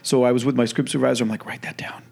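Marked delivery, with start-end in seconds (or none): 1.4-2.0 a quick hushed whisper; whispered aside. Retold or imitated. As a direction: whisper it so the take isn't spoiled. Director whispering to script supervisor.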